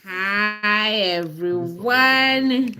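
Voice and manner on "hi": ordinary delivery, nothing marked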